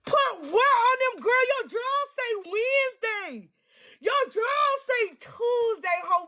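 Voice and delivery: high, slow roast of her younger self; teasing roast. Acted. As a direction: drag yourself, amused and high.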